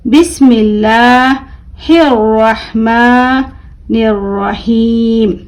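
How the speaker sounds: light, thin L in बिस्मिल्लाहिर्रहमानिर्रहीम, long vowels held; thin L recitation. Shown as a demonstration. Teacher showing pupils the light L in बिस्मिल्लाहिर्रहमानिर्रहीम, slow recitation. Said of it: "जी हाँ, बारीक पढ़ेंगे।".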